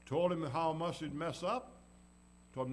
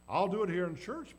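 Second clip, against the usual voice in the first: louder, confident claim; bold boast; 3 semitones higher, 8 dB louder than usual. Acted. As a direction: proclaim it boldly, like in church. Preacher acting a believer's boast.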